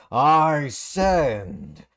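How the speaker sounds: sudden loud bark of anger; loud outburst. Acted. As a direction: slam the words down hard. Player character slams table.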